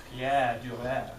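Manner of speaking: slow, softened French way of saying Pierre Dürer; soft French. Shown as a demonstration. Teacher to class, showing how a French name would sound, slow soft French. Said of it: "doesn't sound French, which is a bit softer".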